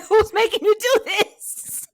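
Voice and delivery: high, slow, laughing retort; mock disbelief. Co-host teasing about the accents, laughing disbelief. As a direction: stretch it out high through laughter. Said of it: "I'm like".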